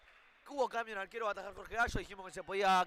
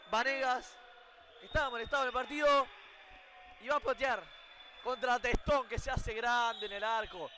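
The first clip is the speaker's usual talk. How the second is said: normal talking voice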